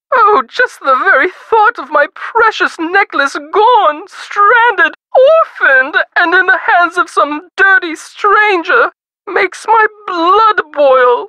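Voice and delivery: high, dramatic indignation; grand, high-pitched distress and fury; theatrical outrage. Acted. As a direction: lament rising into outrage, leaning on each loss. Rich woman outraged at theft.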